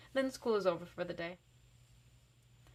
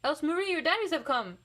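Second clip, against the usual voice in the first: put-on higher, louder boy calling out; shout; 7 semitones higher, 5 dB louder than usual. A narrator's reading. Greg shouting to Else Marie across the room. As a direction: call across the playroom. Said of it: "I hear Greg shout".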